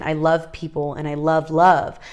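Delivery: ordinary delivery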